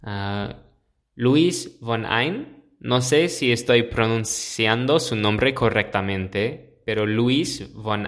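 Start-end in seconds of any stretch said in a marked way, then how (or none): none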